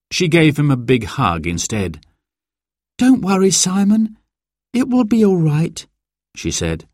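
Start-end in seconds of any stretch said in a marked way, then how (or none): 3.0-5.9 put-on high-pitched girl's voice, comforting; reassuring sister. A narrator's reading. Sarah comforts her upset brother, reassuring. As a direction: reassure him in a high child's voice.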